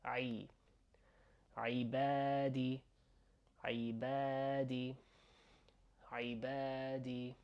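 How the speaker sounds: "عبادي" opening on a throaty "ee", long a; throaty ayn I. Shown as a demonstration. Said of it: "it's that same a".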